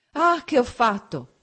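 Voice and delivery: drawn-out horrified exclamation; remorseful cry. A narrator's reading. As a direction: cry out in regret. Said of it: "egli gridò".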